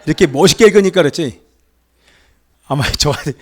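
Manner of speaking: ordinary talk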